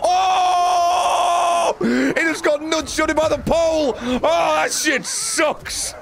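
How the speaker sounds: high slowed laughing yells; shrieking laughing excitement. Real, not acted. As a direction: squeal with glee at the mishap. Excited reaction to game mishap.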